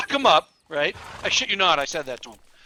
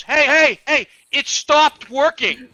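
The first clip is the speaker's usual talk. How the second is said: loud, high, drawn-out call; imitated yelling; 8 semitones higher, 8 dB louder, much slower than usual. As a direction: yell it out like the suspect complaining. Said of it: "he yells at me".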